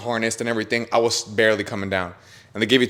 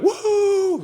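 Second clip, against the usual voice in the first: high-pitched "woo" whoop; re-enacted joyful scream. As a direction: whoop as if gliding through the sky. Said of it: "I'm screaming".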